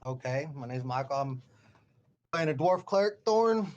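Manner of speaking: ordinary talk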